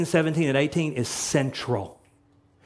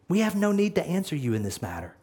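fast, much softer, steady and resolute; quiet defiance; 5 dB quieter, faster than usual. Shown as a demonstration. The three men calmly refusing Nebuchadnezzar, softly. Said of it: "notice what he says".

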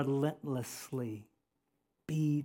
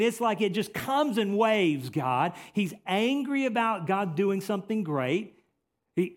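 usual speaking voice

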